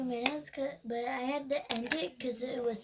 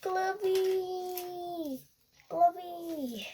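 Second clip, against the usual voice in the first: calling out; 5 semitones higher, 5 dB louder, much slower than usual. Pretend. Calling for lost glove.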